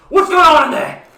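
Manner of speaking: high, very loud demand; alarmed shout. Acted. Sailor turning round to the crowd's commotion, alarmed shout. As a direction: yell it at the commotion.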